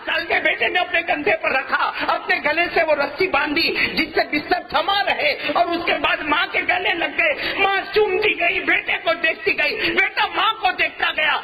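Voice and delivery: a preacher at the emotional peak of the story; full-force preaching. Acted. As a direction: carry the crowd into the farewell scene.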